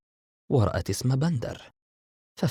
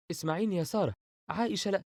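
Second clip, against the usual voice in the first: higher, softer urgent warning; very tense; 10 semitones higher, 3 dB quieter, faster than usual. A narrator's reading. Bandar urgently warning Sara over the phone, tense. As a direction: hurry to warn her, held-back tension. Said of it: "بندر بتوتر شديد".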